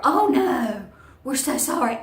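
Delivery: loud, high-pitched mock dismay; hammed-up horrified apology; alarmed manager.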